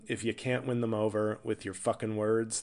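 usual speaking voice

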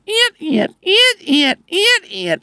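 very high, repeated wiper squeals; rapid-fire high squeaky wiper noise; imitated wiper squeaks. Shown as a demonstration. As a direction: mimic wipers squeaking busily in a downpour. Imitating wipers in heavy rain.

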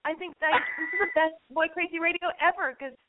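overwhelmed, choked-up thanks; happy tears. Real, not acted. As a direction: gush through real happy tears. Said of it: "I'm crying".

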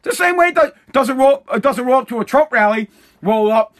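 ordinary talk, nothing marked

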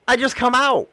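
ordinary talk, nothing marked

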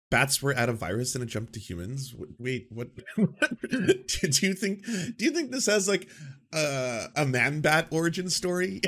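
incredulous. Real, not acted. Incredulous at host's phrasing.

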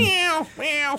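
high mock meowing; cat meow. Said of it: "that is a good meow".

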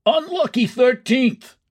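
high-pitched wail of dread; superstitious wail. A narrator's reading. Superstitious fan as the thirteenth inning comes, wailing. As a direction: wail it high, like a bad omen. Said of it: "wailed a superstitious fan".

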